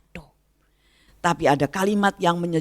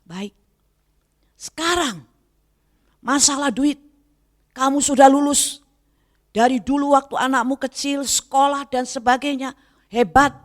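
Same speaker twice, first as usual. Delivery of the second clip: higher, fervent voice of God speaking to her; raised, lightly acted divine voice; 10 semitones higher than usual. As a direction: speak as God gently rebuking.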